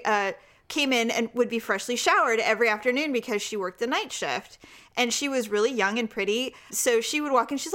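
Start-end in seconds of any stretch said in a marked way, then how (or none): none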